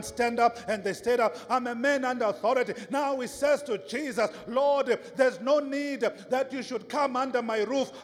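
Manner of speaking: rapid, driving sermon delivery building to a long shouted climax; full-force preaching. Real, not acted.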